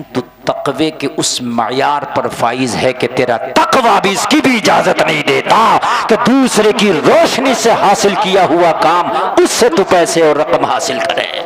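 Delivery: loud, fervent sermon, building to a roar; impassioned preacher at full volume; full-force preaching. Real, not acted. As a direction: thunder it at the congregation.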